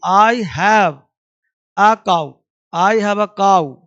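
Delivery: I have a cow stretched out, word by word; very slow model reading. Shown as a demonstration. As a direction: dictate it slowly to the class. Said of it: "teacher models an example slowly". (धीरे-धीरे बोलकर लिखवाना)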